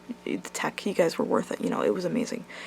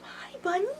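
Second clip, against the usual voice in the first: pet talk; 10 semitones higher, 11 dB quieter than usual. Real, not acted. Greeting her pet bunny in soft baby talk. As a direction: coo it gently up high.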